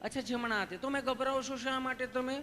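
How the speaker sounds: high, gently questioning nurse voice; affectionate, high-pitched nurse; sweet nurse. Acted. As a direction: ask lovingly in a high voice. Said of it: "nurse બેને સહજતાથી જેમ પૂછતા હતા".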